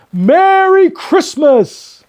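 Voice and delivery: very high, loud and slow joyful call; hearty greeting. A narrator's reading. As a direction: shout it out with full joy. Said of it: "called out a hearty".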